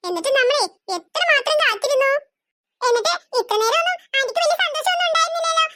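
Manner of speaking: ordinary talk, nothing marked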